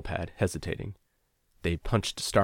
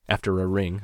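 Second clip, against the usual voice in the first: usual speaking voice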